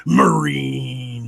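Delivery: drawn-out whirring word; one long droning word; fan-noise imitation. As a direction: stretch the word like a machine's hum. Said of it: "What does it sound like when".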